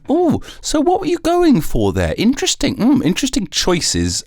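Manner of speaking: high, prissy mock interviewer voice. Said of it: "more like".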